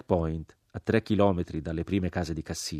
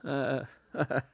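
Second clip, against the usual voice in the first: mocking laugh; 7 semitones higher, 5 dB quieter than usual. A narrator's reading. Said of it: "gridò l'uomo crocifisso".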